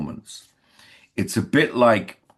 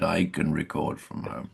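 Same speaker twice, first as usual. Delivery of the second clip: quiet, smug, self-pleased offer; 5 semitones lower, 7 dB quieter than usual. Retold or imitated. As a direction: say it pleased with yourself. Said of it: "this smug little hand went up".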